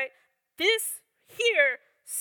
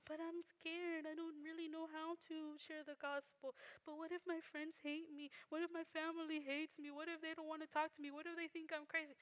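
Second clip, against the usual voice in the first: mock scared excuses; 3 semitones higher, 18 dB quieter than usual. Preacher mimicking fearful churchgoers, hushed and anxious. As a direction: whisper the excuses like a nervous believer. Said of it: "we sit here and we go".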